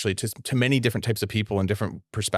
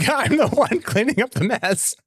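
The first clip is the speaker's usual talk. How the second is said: laughing; 12 semitones higher than usual. Real, not acted. Laughing admission about cleaning up.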